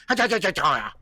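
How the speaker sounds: imitated taunting gibberish syllables; mocking nonsense noise. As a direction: rattle off rapid mock-language syllables. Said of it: "I don't make that dumb noise".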